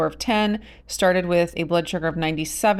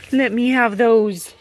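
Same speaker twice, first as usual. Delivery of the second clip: higher, louder, slower coax to the hen; coaxing pet voice; 5 semitones higher, 5 dB louder, slower than usual. Real, not acted. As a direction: sweet-talk the hen out of her eggs.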